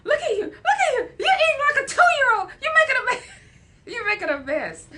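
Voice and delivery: very high, loud laughing mock scold; shrill imitation of a sister scolding a toddler; laughing while mimicking a scold; sister's scolding. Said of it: "I remember my sister saying".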